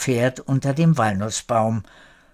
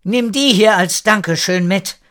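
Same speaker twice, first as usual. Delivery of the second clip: higher, louder friendly call; raised voice of the lady of the manor; 7 semitones higher, 5 dB louder than usual. A narrator's reading. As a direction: call it across the yard. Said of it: "Die Gutsherrin rief nun".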